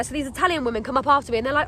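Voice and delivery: ordinary talk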